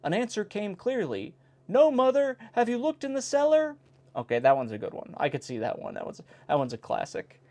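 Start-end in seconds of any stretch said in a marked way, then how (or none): 1.7-3.8 put-on child's reply. Son answers from roof.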